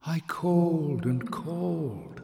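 breathless, slow whisper; sighing whisper. Acted. Lonely voice sighing to Jasmine in a whisper. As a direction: sigh it out in a whisper. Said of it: "sighed the voice".

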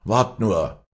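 mild mock threat; young man threatening the vulture. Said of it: "drohte er".

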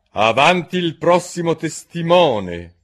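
loud, high, slow courtroom summons; calling out. A narrator's reading. King summons the next witness, calling out. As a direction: call out officially across the room.

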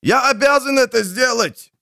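gurgled through water; gurgling. Acted. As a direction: speak as if choking on water. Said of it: "пробулькал Ануэрт".

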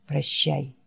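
low, soft, worn-out creature voice; barely has the strength to say the name; weary dying Wolf. Acted. Wounded Wolf calling his friend Jack, low and faint. Wolf's voice.

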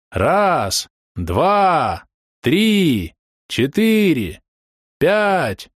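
high, drawn-out count, word by word; crowd counting. A narrator's reading. Tables count out the couple's kiss, chanting high. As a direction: chant each number like guests. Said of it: "принялись считать за столами".

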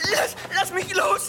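panicked plea. Acted.

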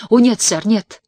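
sharp rising cry of refusal; exclaiming. A narrator's reading. Tess recoiling from him, a loud startled no. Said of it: "воскликнула она".

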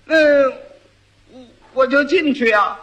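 hum, stumble, then answer; a hint of a dim-witted groom; hesitant fool. Fool answers wife's test question.

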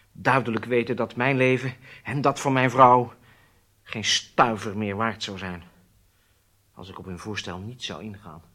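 ordinary talk

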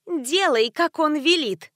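high, slow squeaky voice; squeaky nasty voice. A narrator's reading. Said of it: "пропищал чей-то противный голос".

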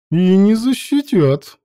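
smugly pleased; smug chuckle. A narrator's reading. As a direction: say it with a satisfied smirk. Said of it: "довольно усмехаясь".

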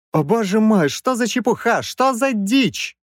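high-pitched indignant protest; flustered professor. A narrator's reading. Professor protests at avenger's claim.

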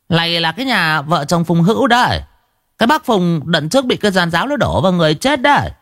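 high, chattering gossip woman voice; high-pitched gossiping old woman; nosy village gossip. A narrator's reading. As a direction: spill the news like an eager village busybody. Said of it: "Một mụ hóng hớt liền bảo".

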